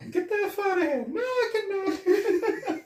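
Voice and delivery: put-on very high, stretched silly accent; Serge impression. Retelling Serge's refusal, squeaky drawn-out mock voice. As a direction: squeal it slowly through laughter. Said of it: "And then he's like".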